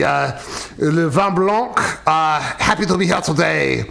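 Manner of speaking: lightly put-on French accent; a hint of a suave French wine expert; french chef. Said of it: "uh, Pierre, can you, uh, come in here?".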